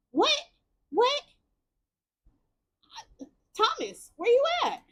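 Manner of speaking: very high squeaky sputtering "what"; hammed-up disbelief. Streamer hearing nobody has seen Thomas, hammed-up disbelief.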